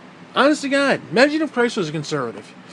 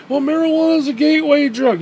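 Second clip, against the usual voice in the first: mock preachy parent; 6 semitones higher, 4 dB louder than usual.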